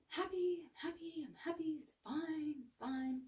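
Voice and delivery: a strained fake-cheerful voice; forced happy denial. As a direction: chant it to cover up being upset. Mocking suppressed anger, forced happiness.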